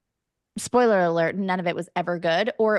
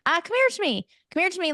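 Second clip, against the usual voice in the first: high Irish lilt, "come here to me" dropping at the end; Irish accent; 10 semitones higher, faster than usual. Shown as a demonstration. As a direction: beckon someone closer the Irish way. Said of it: "you need to come closer".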